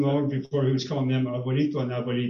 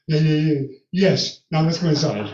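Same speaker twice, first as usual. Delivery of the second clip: eager yes; 2 semitones higher, 7 dB louder than usual. Retold or imitated. Fiancée accepting, eager in cold.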